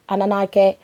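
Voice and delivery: usual speaking voice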